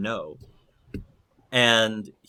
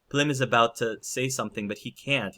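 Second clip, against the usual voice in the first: normal talking voice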